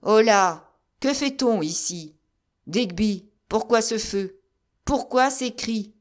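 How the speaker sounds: loud, resonant command; ringing voice. A narrator's reading. General hailing his soldiers, sonorous and loud. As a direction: call out with authority. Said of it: "d'une voix sonore".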